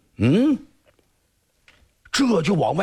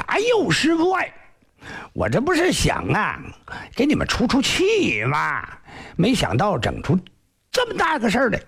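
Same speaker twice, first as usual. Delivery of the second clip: high, wheedling plea; a lightly voiced cheeky disciple begging his master; pleading disciple; 8 semitones higher than usual. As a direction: coax the master out of the punishment. Zhang Wang pleads with master.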